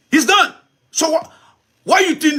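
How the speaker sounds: bursting in, thrilled the speech is over; excited. Real, not acted. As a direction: pop up with real excitement.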